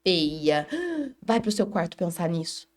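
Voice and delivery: lento e dramático, com suspiro de susto; mãe exagerada. Retold or imitated. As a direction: exagere a bronca teatral. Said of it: "você fala". (mãe fingindo escândalo com a criança)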